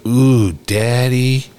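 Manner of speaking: dad imitating his young son's call; little son calling. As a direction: stretch it out like an excited little boy.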